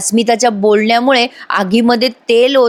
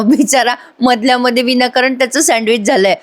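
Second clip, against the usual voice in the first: normal talking voice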